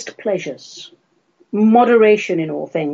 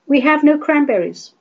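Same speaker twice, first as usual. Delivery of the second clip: normal talking voice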